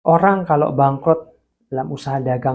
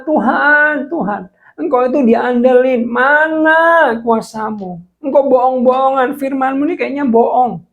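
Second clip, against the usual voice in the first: anguished weeping plea; 10 semitones higher, 6 dB louder than usual. Retold or imitated. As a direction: sob out the betrayal to God. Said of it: "saya nangis, saya kecewa". (ratapan pilu kepada Tuhan)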